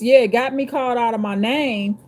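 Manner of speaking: ordinary delivery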